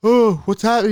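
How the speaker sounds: high, loud mock alarm; hammed-up startled question; alarmed boys. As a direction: imitate scared kids calling out. Boys react as car rolls.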